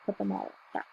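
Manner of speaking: fading echo of the line before; quiet echo. A narrator's reading. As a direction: let it fall away to near nothing. Narrator's image repeated as soft echo.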